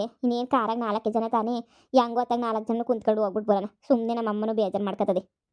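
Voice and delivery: ordinary delivery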